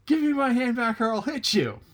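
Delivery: high, loud, frantic rabbit voice; demanding rabbit. Acted. Stuck rabbit yells at tar doll, high and loud. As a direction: yell for your hand back.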